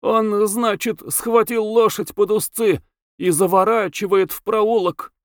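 put-on whining young cabman, voice way up; high, pleading character voice; plaintive high voice. A narrator's reading. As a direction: complain in a high, halting voice. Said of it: "жалобно, высоким голосом говорил, запинаясь".

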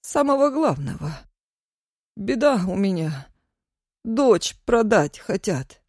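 a touch of pitiful begging; terrified pleading hostage. Acted. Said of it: "жалобным дрожащим голосом".

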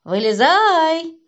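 протяжный громкий крик повыше; крикни, растягивая слово, будто зовёшь спрятавшегося. A narrator's reading. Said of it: "стали стучать по крышке и кричать". (протяжно кричать)

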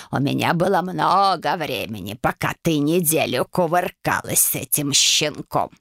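low, slow croaking hag; old witch voice. A narrator's reading. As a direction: croak it like a greedy crone.

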